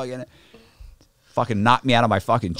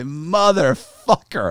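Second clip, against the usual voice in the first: slow, high, louder drawn-out swear; indignant curse; 8 semitones higher, 5 dB louder, much slower than usual. Retold or imitated. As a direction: imitate outraged disbelief, stretching the word. Billy reacts to being blamed.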